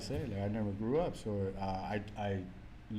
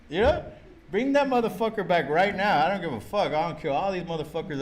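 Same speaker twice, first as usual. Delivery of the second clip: higher and louder, raging; angry; 6 semitones higher, 6 dB louder than usual. Real, not acted. Raging at God over dead friends, loud and angry. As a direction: vent it hot and loud.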